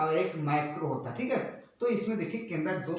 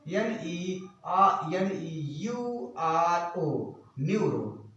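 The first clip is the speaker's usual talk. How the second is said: slow, letter by letter; measured dictation of single letters; slow spelling-out; much slower than usual. Real, not acted. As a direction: spell it out for note-takers. Spelling neuro for students.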